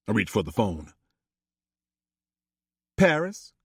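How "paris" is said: higher, calling her daughter's name with a rising lift; mother calling. Acted. Chippy calls out to Paris, disapproving, higher voice. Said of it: "Chippy called".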